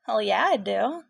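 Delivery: loud, lifted and carefree; lighter, brighter voice. Acted. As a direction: let the weight drop off the voice. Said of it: "Your voice is noticeably lighter".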